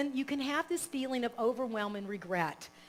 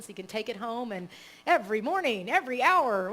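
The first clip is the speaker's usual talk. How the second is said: usual speaking voice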